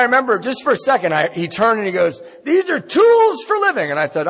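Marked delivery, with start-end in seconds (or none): 2.4-3.9 high, loud, drawn-out declaration; mock proclamation. Newcomer mimics the man at the podium, high loud mock proclaiming. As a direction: proclaim it like an over-keen meeting speaker. Acts out speaker addressing the meeting.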